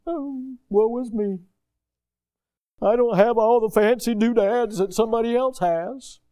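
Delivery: high, loud mocking whine of a spoiled complainer; mock whining about what others have; whiny complainer. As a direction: ham up the self-pitying grumbler.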